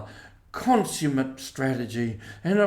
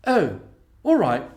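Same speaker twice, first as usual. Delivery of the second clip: ready agreement; 3 semitones higher, 5 dB louder than usual. A narrator's reading. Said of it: "said the Toad readily".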